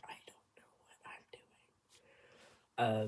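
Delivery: rapid whisper; whispered aside. Retold or imitated. Streamer retelling their doubts about preparing, whispered. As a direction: whisper it fast under your breath.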